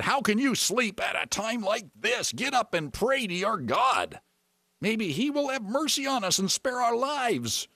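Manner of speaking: high, urgent rousing demand, last words drawn out; urgent captain. Acted. Captain rousing sleeping Jonah to pray, urgent and high. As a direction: plead urgently at a high pitch.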